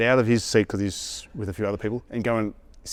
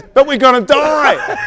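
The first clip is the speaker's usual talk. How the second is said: panicked shout; 13 dB louder, much slower than usual. Retold or imitated. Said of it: "He goes".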